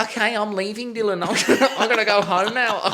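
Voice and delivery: high, laughing quick exit line; mock flustered date. Date bailing out, in a high laughing voice. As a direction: squeak it out while giggling.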